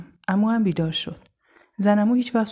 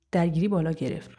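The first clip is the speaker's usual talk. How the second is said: usual speaking voice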